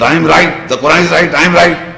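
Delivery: mock cocksure insistence, hammered out in bursts; arrogant. Children arguing with their fathers, arrogantly insistent. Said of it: "speak to their fathers in an arrogant".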